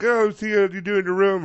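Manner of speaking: high, loud Italian dad impression; super Italian accent. Roommate's dad greeting her, hammed-up Italian accent. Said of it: "like, super Italian".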